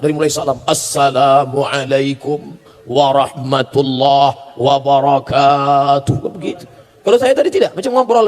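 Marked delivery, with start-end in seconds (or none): 0.7-6.2 slow, drawn-out, low formal preacher greeting; Zainuddin MZ formal style. Retold or imitated. Mocking a formal preacher's greeting, slow and stately. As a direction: stretch each syllable like a grand pulpit preacher. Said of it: "lihatlah bagaimana Zainuddin".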